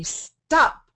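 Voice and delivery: sudden high, loud "Stop!"; commanding shout. Shown as a demonstration. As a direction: shout it like halting a bad thought. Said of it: "and say".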